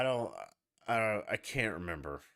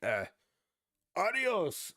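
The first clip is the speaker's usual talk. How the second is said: mock English reply; 8 semitones higher than usual. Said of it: "the English are like".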